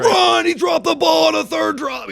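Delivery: frantic high shout to a runner; hammed-up sports dad screaming from the bleachers; yelling sideline parent. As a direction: holler it urgently across the field. Acts parent shouting at player.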